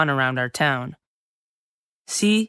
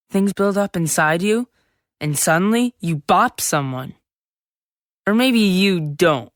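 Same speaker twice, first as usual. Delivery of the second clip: usual speaking voice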